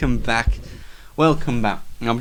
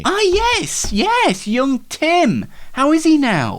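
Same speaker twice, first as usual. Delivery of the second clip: much higher, sing-song host; mock-sentimental high host voice; high fond voice; 11 semitones higher, 3 dB louder, slower than usual. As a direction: lift way up with mock fondness. Host reacts brightly to Tim.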